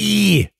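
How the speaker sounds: "ear" high and long as EEE; demonstrated vowel. Shown as a demonstration. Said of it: "Like that".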